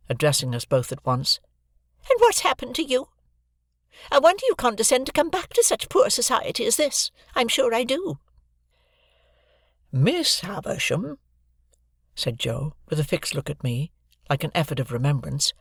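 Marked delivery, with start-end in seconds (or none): 2.1-8.2 much higher, huffy and cutting; sarcastic sister. A narrator's reading. Mrs Joe scolding the returning pair, high and sarcastic. 9.9-11.2 higher, slow, earnest blacksmith; fumbling for grand words, sincerely; Joe's voice. A narrator's reading. Joe thanking Miss Havisham, earnest and fumbling. Joe begins his invented message.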